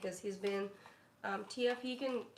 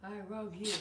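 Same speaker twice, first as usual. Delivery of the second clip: dog's voice; 2 semitones lower than usual. Pretend. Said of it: "Okay, ready?".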